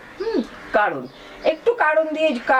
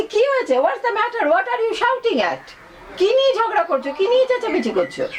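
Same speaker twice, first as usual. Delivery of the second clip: পিতার উঁচু গলায় অবাক প্রশ্ন; a father bursting in, high and startled; high surprised father; 9 semitones higher than usual. Acted. Father bursts in, Bengali version. (ঝগড়া শুনে বাবা ঢুকে উঁচু গলায় অবাক হয়ে জিজ্ঞেস করছেন)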